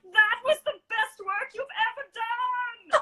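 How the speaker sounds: high, slow, quavering put-on voice of the department head; mock him as a wobbly, high-pitched old teacher; impression of department head. A student mimicking the department head praising her work, high and slow. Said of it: "I love how you still did an impression of him right now".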